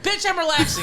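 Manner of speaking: high, laughing mock defiance; laughing sass. Friend voicing her reply to boyfriend, high and laughing. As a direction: toss it off high through a laugh.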